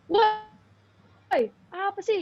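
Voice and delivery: high-pitched mock angry yell; retold flare-up, pitch leaping way up; acting out real anger. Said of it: "yang bener marah kayak".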